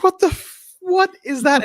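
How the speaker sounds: very high, horrified inner yelp; squeaky, appalled self-rebuke; shocked at himself. Retold or imitated. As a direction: recoil in disbelief at what you just said. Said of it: "I was like".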